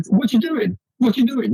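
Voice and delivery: high, chirpy mock barber question; hammed-up eager hairdresser. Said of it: "I hate it when they start off straight away".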